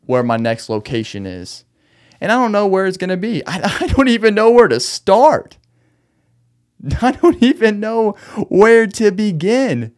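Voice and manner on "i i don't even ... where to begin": laughing. Real, not acted. Laughing at his own anger.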